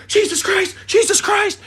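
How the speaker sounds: imitating the panicking dog owner; panicked owner. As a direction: squeal it like the frantic owner. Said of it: "they're like".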